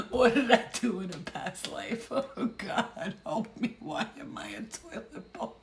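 loud mock lament, breaking into laughter; hammed-up tearful pleading; crying toilet. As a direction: wail to the heavens as a miserable toilet. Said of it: "I'm seeing a toilet crying".

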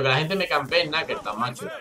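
ordinary talk

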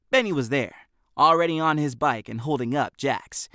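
plain reading voice

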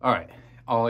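winded after a tight crawl; catching breath between words. Real, not acted. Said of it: "Clearly out of breath from that crawl in".

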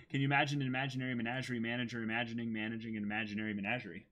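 quick careful tongue twister; fast tongue twister. Shown as a demonstration. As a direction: rattle it off quickly. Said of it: "Okay, fine, fine".

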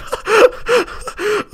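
shrill burst of laughter; high squealing laugh. Real, not acted. High laugh as the noob dies in a second. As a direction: crack up at the instant death.

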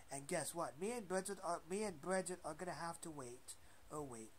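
usual speaking voice

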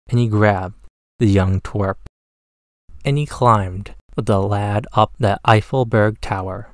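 usual speaking voice

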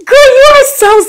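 touched high squeal. Real, not acted. Touched by a kind comment.

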